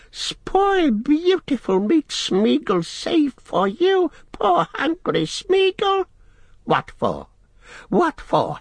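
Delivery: high, whining creature wail; dismayed squealing. A narrator's reading. Gollum pleads for raw rabbits, dismayed squealing. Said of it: "squealed Gollum in dismay".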